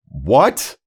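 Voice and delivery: loud, high questioning shout; yelling back. Retold or imitated. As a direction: shout it back across the room. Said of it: "we're both yelling back and forth".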